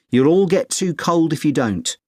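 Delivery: ordinary talk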